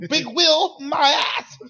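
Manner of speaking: high, loud scoffing jeer; hammed-up heckler; mock offended protester. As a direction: scoff like an angry fan. Mocking offended listeners.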